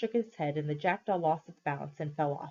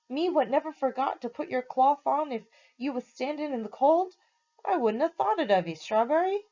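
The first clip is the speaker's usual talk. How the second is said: higher, indignant London cabby voice; hurt cabby; 7 semitones higher than usual. A narrator's reading. Cabby to his horse who forgot him, hurt and indignant. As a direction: protest like a wounded old friend.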